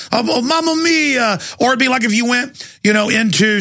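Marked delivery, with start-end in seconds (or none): 0.1-1.4 high, drawn-out cartoon Italian; fake Italian accent. Mocking customer at an Italian restaurant, fake Italian accent. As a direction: exclaim in a hammed-up Italian accent. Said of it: "some kind of fake Italian accent".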